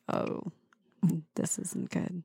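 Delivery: unvoiced whisper of dread. Guest dreading another dad joke, whispering. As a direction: breathe it as a whispered groan. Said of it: "it's like".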